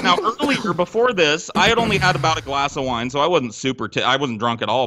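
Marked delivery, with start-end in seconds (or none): none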